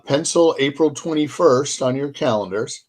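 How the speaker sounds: ordinary talk, nothing marked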